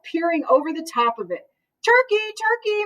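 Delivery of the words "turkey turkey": high sweet coaxing call; sweetest voice. Acted. Mister Moose luring Turkey from his nest, sweetest voice. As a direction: coax the turkey sweetly out of hiding. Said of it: "in his sweetest voice".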